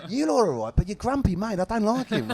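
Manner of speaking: high mock Australian accent; hammed-up Aussie manager's voice, pitched high; Joe Montemurro. Manager ribbing podcasters about their grumpy mate, Australian accent. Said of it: "Sorry about the accent".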